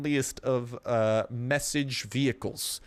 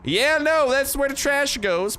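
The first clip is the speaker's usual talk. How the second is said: loud mocking; 12 semitones higher, 4 dB louder, slower than usual. Real, not acted. Mocking the raider's base.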